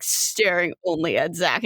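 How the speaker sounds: ordinary talk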